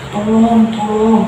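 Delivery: mock cry for help. Possessed mother calling her family for help, mock cry. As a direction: imitate a mother crying out, not at full scream. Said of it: "si ibu itu masih teriak-teriak".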